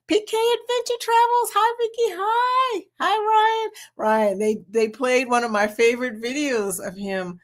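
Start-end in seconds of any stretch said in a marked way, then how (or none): 0.1-3.7 very high excited greetings; high-pitched thrilled welcome; squealy hello. Real, not acted. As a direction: squeal hi to your friends.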